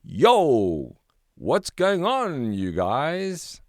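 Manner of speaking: a big, loud, jaunty yo to startle the friends; shouted greeting. A narrator's reading. Silver fish leaps out and hails the friends, loud and jaunty. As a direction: call out cheerily from the water. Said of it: "jumped out of the water and shouted".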